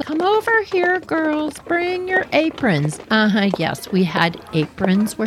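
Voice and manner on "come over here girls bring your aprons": much higher sweet teacher voice; teacher calling. Retold or imitated. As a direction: call the girls over like the tiny teacher. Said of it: "Missus Paris is waving her hand".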